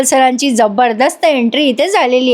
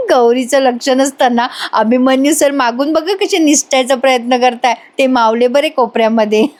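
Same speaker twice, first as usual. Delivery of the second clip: ordinary talk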